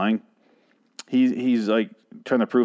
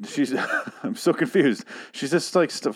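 normal talking voice

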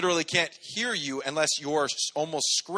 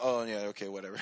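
bored shrug; 6 semitones lower, 7 dB quieter, faster than usual. Retold or imitated. Child shrugging off timeout.

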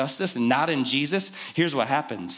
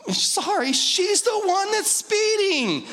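mocking protest. Mocking wife to the cop.

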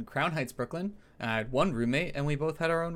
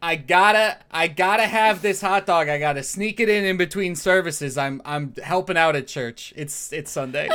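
Doctor Joey voice; 4 semitones higher, 7 dB louder than usual. Acted. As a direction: announce yourself like a brash regular. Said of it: "Doctor Joey".

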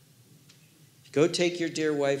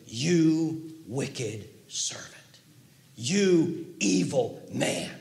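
stern king; much slower than usual. Acted. King rebuking the servant.

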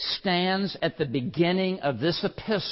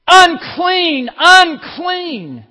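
much higher and louder, each word falling; anguished cry; 16 dB louder, much slower than usual. Acted. Sinner crying his uncleanness to God, anguished shout. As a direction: wail it like a leper.

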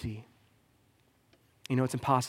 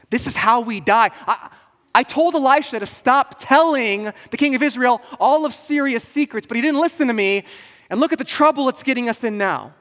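high, loud panicked rant; panicked servant; 10 semitones higher, 11 dB louder than usual. Retold or imitated. Servant panicking to his master, high and loud. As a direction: panic like the doom has come. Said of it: "so he freaks out".

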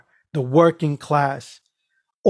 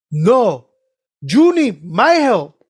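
slow, loud, high-pitched insistence; frustrated; 4 semitones higher, 5 dB louder, much slower than usual. Retold or imitated. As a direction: imitate someone losing patience. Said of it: "he was getting frustrated with me".

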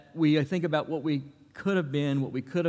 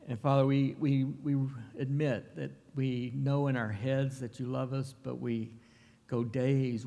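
soft, measured prayer voice, often trailing quieter; Father's Day prayer to open the service; earnest prayer; 3 semitones lower, 4 dB quieter than usual. Acted. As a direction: lead the church in heartfelt prayer. Pastor leads opening prayer.

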